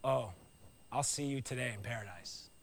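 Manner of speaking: low, soft gentle reassurance; tender promise. Retold or imitated. Jesus reassuring the dying thief, low and soft. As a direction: comfort him quietly. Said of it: "And Jesus says".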